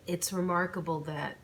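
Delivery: ordinary delivery, nothing marked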